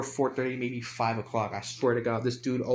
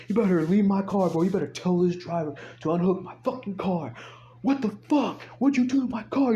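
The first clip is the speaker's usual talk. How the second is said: loud, high outraged rant; retold yelling; 7 semitones higher, 4 dB louder than usual. Car owner yelling at the cop about towing, yelling. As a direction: imitate a man yelling, climbing higher as he goes. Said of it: "he started yelling".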